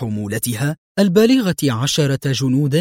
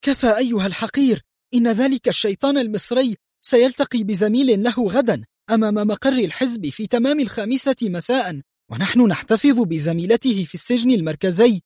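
high-pitched exasperated outburst; furious, resentful; 9 semitones higher than usual. A narrator's reading. Tortured woman spilling the information, resentful. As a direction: give in angrily, pitch held high throughout. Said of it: "ثم هتفت في حنق".

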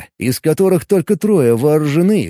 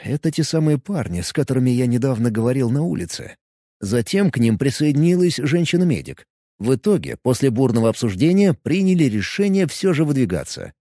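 ordinary delivery, nothing marked

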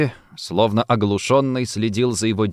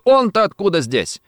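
startled, higher inner exclamation; a touch of alarm, pitched up; frightened; 7 semitones higher than usual. A narrator's reading. Rossetti spots the man with the revolver, frightened. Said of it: "испуганно ёкнуло в душе Рассетти".

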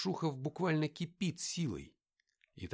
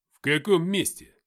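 loud, higher-pitched indignant question; flaring up; 4 semitones higher, 12 dB louder than usual. A narrator's reading. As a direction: flare up and challenge him loudly. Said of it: "вспылил Алексей". (вспылив, громко и возмущённо)

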